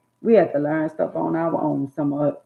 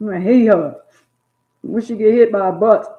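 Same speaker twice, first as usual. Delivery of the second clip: mock angry outburst; 5 semitones higher, 7 dB louder than usual. Child's angry outburst retold.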